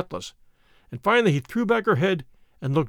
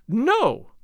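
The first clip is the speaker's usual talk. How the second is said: cried out; 6 semitones higher than usual. A narrator's reading. Woman refusing the captain, crying out. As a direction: fling the refusal at him. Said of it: "she cried".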